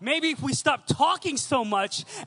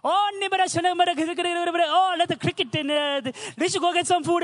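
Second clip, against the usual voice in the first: loud, high, fast Tamil-English phone chatter; Sri Lankan uncle on the phone; 6 semitones higher, 5 dB louder than usual. Retold or imitated. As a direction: play an excited uncle rattling on down the line. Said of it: "it's like broken Tamil and English together".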